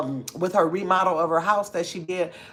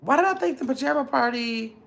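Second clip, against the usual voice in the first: high, puzzled questioning; confused; 7 semitones higher than usual. Real, not acted. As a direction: trail off and restart in bewilderment. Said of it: "I'm confused now".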